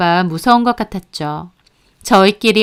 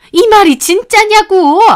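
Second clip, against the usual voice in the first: much higher, louder, pressing demand; demanding shout; 10 semitones higher, 6 dB louder than usual. A narrator's reading. Mother demanding her son confirm the affair, loud demand. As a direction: demand the truth from your son.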